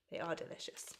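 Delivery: soft whisper, savouring; whispered aside. Real, not acted. Vlogger telling viewers the mini party rings taste great, whispered. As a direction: whisper it like a tasty secret.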